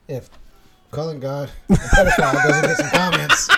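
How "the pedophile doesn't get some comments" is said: talking through loud laughter, pitch flying up; laughing. Real, not acted. Host cracks up at his own jab, laughing.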